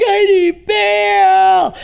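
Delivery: high, loud, slow whimpering wail; mock crying plea. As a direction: ham up a blubbering plea for bail. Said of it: "he became the crying Nazi".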